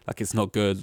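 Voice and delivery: ordinary talk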